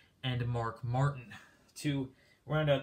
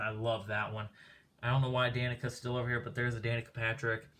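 usual speaking voice

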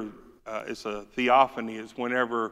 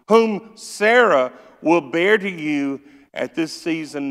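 loud, raised scripture reading; preaching at full force; 6 semitones higher, 10 dB louder than usual. Acted. Preacher booms God's promise to Abraham, loud and raised. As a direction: thunder the promise out to the congregation.

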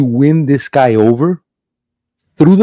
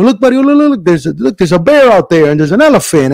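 put-on higher, eager talk to a child; acted parental excitement; excited distracting dad; 4 semitones higher than usual. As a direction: point out wonders to distract a crying kid. Dad distracting his crying son.

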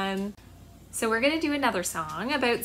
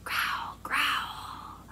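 low whispered growl; 6 dB quieter than usual. Shown as a demonstration. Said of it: "make a big growl".

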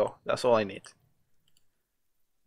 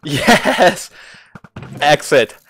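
loud, raised victory cry; triumphant cheer; 3 semitones higher, 7 dB louder, much slower than usual. Real, not acted. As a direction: cheer at finally making it. Finally reaches the exit.

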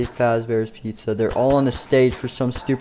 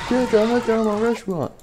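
high, odd-voiced angry yell; mad Freddy; 11 semitones higher than usual. Mad Freddy yelling at people wrecking his place. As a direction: yell it out in a put-on voice. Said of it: "Freddy started getting mad and go".

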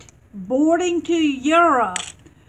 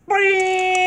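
boing sound effect; 7 semitones higher, 5 dB louder than usual. Pretend. Squeezing the squishy eyeball.

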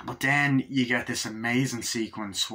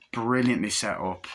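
plain reading voice

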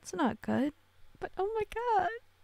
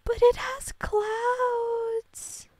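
high, slow, louder squeal of delight; 11 semitones higher, 7 dB louder, much slower than usual. Real, not acted. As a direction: stretch each word out in a squeal. Delighted at cloud dress.

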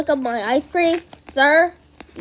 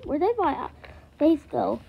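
ordinary talk

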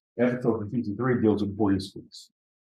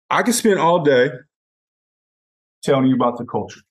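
emphatic declaration; 2 semitones higher, 8 dB louder than usual. Real, not acted. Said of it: "emphatic passion".

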